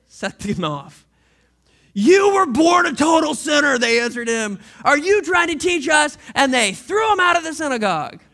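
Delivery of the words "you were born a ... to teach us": louder furious shouting; angry shout. Acted. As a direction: condemn him with outrage. Leaders furious, throw him out.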